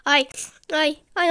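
ordinary talk, nothing marked

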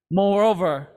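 normal talking voice

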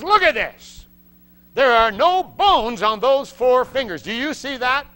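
high, loud amazed doctor; doctor showing the x-ray in disbelief; astonished doctor. Retold or imitated. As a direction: demand it like a stunned doctor.

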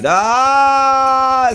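long, high, loud "aah"; battle cry. Real, not acted. Gamer charging at an enemy, long battle cry. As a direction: hold a high yell as you charge.

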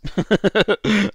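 amused chuckle; real laugh. Real, not acted. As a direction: laugh at the flub. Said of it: "the production values".

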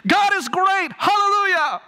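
put-on high, loud, childlike shout of joy; boy hollering praise. A preacher voicing the boy shouting hallelujah on a bench. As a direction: holler it like an excited little boy. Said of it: "continues shouting in praise to Jesus".

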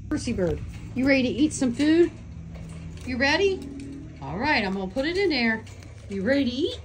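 high, loud excited pet talk. Real, not acted. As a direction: call the bird to dinner, high and bright. Calling bird to food.

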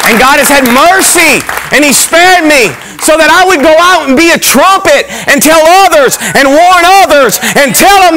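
fired-up sermon climax; preaching at full force. Real, not acted. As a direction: lift it into a revival call. Testimony rising into preaching.

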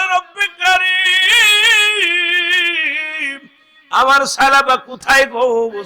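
high, drawn-out sung lament; tearful plea. Acted. Preacher acting the weeping Prophet pleading for Salaba, sung tearful plea. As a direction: cry out to God in a melodic wail. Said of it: "নবীজি চোখের পানি ছেড়ে কান্দে আর বলে".

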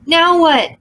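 loud, high breathless question; breathless panic. A narrator's reading. Minnie panics as the demon recovers, breathless. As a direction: gasp it out in panic. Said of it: "asked Minnie, breathlessly".

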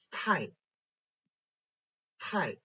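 太 in fourth tone, high and falling; tone drill. Shown as a demonstration. Teacher reading for students to circle, 太 falling. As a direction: drop 太 quickly from high, softly. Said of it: "focus on the tones".

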